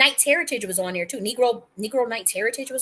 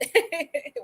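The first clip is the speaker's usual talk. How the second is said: short laugh; 6 semitones higher than usual. Real, not acted. Laughs mid-rant.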